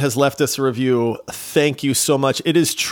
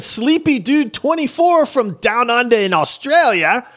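hammed-up bad Australian accent; butchered Australian accent; 10 semitones higher, 3 dB louder than usual. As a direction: put on a clumsy Aussie accent. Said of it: "I butcher that accent every single time".

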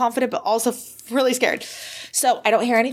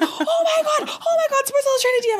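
much higher, laughing squeal of joy; reliving a scream. Retold or imitated. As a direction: shriek it like you just got the message. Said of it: "like, screaming".

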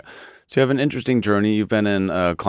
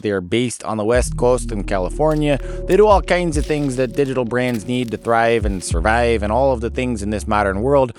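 put-on outrageous Great Lakes accent ad read; hammed-up Great Lakes accent, sponsor spot. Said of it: "I'm using this outrageous Great Lakes accent".

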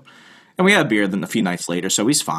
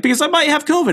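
much higher mock voice of a panicked friend; retelling a friend's call, imitating him much higher; 3 dB louder, faster than usual. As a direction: play the friend up high and fretful. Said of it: "He's like".

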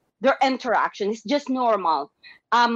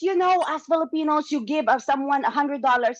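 ordinary talk, nothing marked

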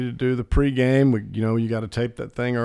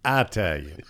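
lower, imitated dad's EYE-talian; dad's accent; 7 semitones lower, 4 dB quieter than usual. Asked to repeat dad's word, dad's Southern accent. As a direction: repeat dad's "Italian" as eye-TAL-yun, pitched down. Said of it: "As my dad would say".

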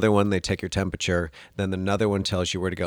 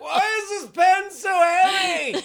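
1 pained laughing shout; slower than usual. Retold or imitated. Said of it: "It's like".

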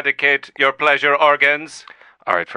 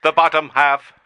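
robot voice; 8 semitones higher than usual. Acted. AI characters argue in voices.

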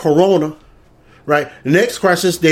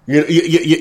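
ordinary talk, nothing marked

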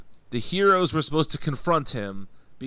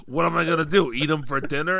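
loud, raised mock exasperation; sarcastic retort; 3 semitones higher, 6 dB louder, faster than usual. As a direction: throw it back as an exasperated worker. Retelling morgue worker's sarcastic retort.

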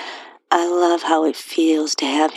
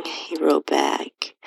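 ordinary delivery

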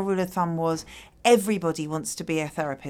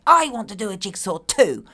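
put-on demanding child; 2 semitones higher, 6 dB louder than usual. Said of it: "stamp your foot and say".

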